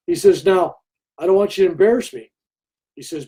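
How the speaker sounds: ordinary talk, nothing marked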